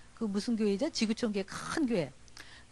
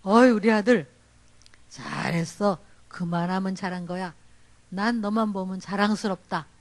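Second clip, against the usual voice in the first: proud father; 5 semitones lower than usual. Retold or imitated. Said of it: "아빠가".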